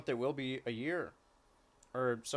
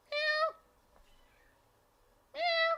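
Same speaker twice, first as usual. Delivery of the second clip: high squeaky cat meow; high mock meow; 4 dB louder than usual. To the cat, answering its calls with a high meow.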